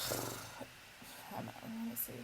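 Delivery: slow whispered mutter. Real, not acted. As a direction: murmur while distracted. Muttered while looking up phone.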